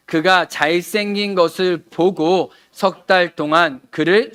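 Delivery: loud, slow, measured Bible reading; scripture reading. Acted. Pastor reading Exodus aloud to the congregation, loud and slow. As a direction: read the passage out to the whole hall, slowly.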